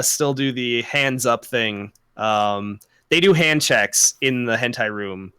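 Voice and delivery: ordinary talk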